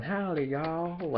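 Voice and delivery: high, slow hammed-up Southern howdy; exaggerated Southern accent greeting; mock Southern drawl. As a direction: play the cartoon Southern cowboy, stretched out. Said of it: "stereotypical Southern guy going".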